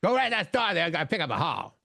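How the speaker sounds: high-pitched mock Cajun, barely intelligible; exaggerated Southern Cajun imitation; thick Cajun accent. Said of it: "the way I've heard people do it".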